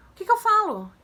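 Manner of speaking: high, loud plea for help; flustered teacher. Retold or imitated. Acts flustered teacher asking help. (professora aflita, voz aguda e alta)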